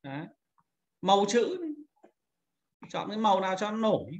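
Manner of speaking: ordinary talk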